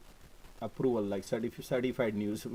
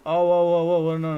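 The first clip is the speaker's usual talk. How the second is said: alarmed oh no; 6 semitones higher, 11 dB louder than usual. Real, not acted. Alarmed at loss on put.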